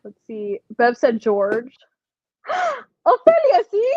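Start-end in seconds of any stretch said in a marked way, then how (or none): 2.5-3.9 gasped, high and drawn out; a delighted gasp of recognition, slowly; excited gasp. Real, not acted. Spots auntie's name in chat.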